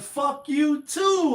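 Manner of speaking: slow, high, drawn-out mock comeback; lightly voiced Eddie Murphy comeback; hint of Eddie Murphy, slowed way down; Eddie Murphy impression. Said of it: "Like some Eddie Murphy shit".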